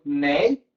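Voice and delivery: nei set off alone after a pause; isolated syllable. Shown as a demonstration. Teacher divides apneia for students, detached middle syllable nei. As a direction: detach nei as its own beat. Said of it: "Como é que a gente separa".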